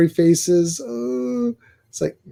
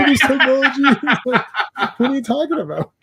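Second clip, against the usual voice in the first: mock old parent; 7 semitones higher than usual. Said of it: "mock clueless old parent".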